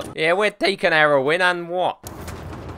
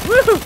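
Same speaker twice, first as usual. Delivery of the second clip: short loud, high woohoo; quick gleeful cheer, pitched up; high whoop; 6 dB louder than usual. Real, not acted. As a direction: whoop out in delight. Cheering in play.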